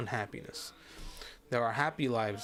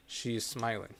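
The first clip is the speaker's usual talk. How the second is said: ordinary delivery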